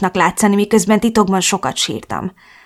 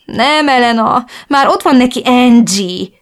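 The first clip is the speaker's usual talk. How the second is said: sniffling; 5 semitones higher, 4 dB louder than usual. Acted.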